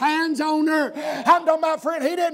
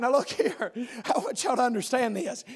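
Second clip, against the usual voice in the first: normal talking voice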